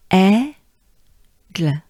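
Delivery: Aigle said as EH-gluh, French e. Shown as a demonstration. Teacher models Aigle for learners, French style. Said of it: "we focus on the e".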